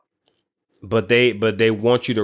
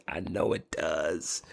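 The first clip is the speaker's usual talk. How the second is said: whispered aside; whispering; 5 semitones lower, 12 dB quieter, slower than usual. Real, not acted. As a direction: whisper it to yourself. Repeated softly to self.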